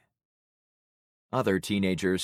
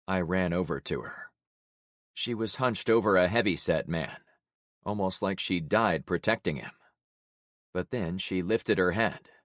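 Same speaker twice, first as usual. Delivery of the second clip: ordinary delivery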